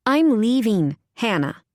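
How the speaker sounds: firm, final, falling Hannah. A narrator's reading. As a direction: say Hannah like it's settled. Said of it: "in a tone that brooked no argument".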